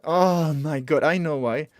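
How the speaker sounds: loud, stretched oh of discovery; sudden realisation. Real, not acted. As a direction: let the penny drop, slowly and loudly. Suddenly realises cause of the bug.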